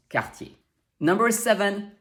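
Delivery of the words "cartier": quartier as "kar-TYAY" with throaty R; French pronunciation. Shown as a demonstration. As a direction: say quartier with a silent final R. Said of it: "so you know it's pronounced".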